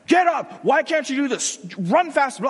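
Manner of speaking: loud, high, demanding shouts; harsh coach yelling. Retold or imitated. Tough coach yelling at a beaten-up player. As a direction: bark orders at a struggling player. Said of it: "Denzel's been super mean to this guy".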